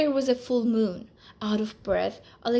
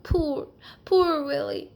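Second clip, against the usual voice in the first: near tears; 5 semitones higher, 4 dB louder than usual. Acted. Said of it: "almost in tears".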